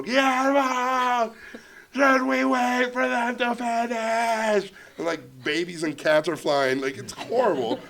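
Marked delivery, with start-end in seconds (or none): 0.0-4.7 high, drawn-out holler; yelling. Retold or imitated. Director yelling to producer over leaf blowers, slow high holler. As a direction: yell slowly over a roaring noise. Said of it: "And I yell again".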